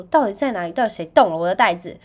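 loud, exasperated shouting at husband; retold loud angry outburst; angry demand. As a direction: demand where the bag went. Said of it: "然后我就很生气".

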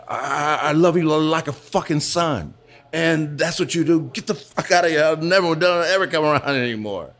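a light imitation of a hurt boss, tearful and furious; tearful rage. Boss throwing out the dancer who punched him, tearful and angry. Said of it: "and he was crying".

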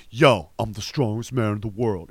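a hint of a boastful gym bro. A bodybuilder on an American reality show in a mild bro voice. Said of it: "that would be a bro fest".

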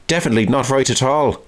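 a hint of a blustering major; imitating the Major. Diarist mimicking the camp commandant's stock phrase, lightly. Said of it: "jerked and stammered out his opinions".

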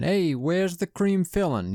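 a hint of the cartoon shark; kids repeating the line. A cohost imitates kids repeating the catchphrase, lightly voiced. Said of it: "kids to just repeat this line".

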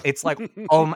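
normal talking voice

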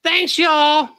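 high, laughing put-on guest voice; Mary Kay voice. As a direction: pitch way up to play the guest. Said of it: "And I have a special guest".

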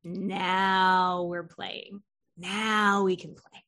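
very slow, savoring each word; slow satisfied quote. Retold or imitated. Therapist pleased as the client finally cries, slowly. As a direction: relish the breakthrough slowly. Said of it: "And I was just like".

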